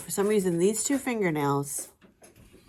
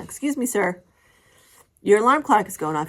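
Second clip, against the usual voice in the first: louder, polite but pointed wake-up; wife wakes husband about his alarm, loud and polite; retold wake-up call; 3 semitones higher, 7 dB louder than usual. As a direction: call him awake, politely exasperated. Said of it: "wake him up and say".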